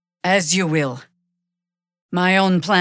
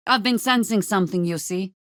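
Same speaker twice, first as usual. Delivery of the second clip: normal talking voice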